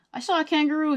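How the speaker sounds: ordinary delivery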